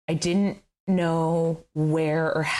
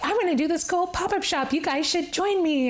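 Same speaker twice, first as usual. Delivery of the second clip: excited; 11 semitones higher than usual. Retold or imitated. Retold own upbeat pitch to friends.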